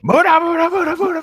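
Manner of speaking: high, loud laughing anime battle cry; Muda barrage shout. Pretend. Friend parodying an anime punch barrage, shouting high. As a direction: fire off the Muda rush like a villain.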